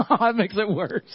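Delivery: high-pitched words through real laughter; laughing. Real, not acted. Pastor laughing at his wife's reminder, laughing.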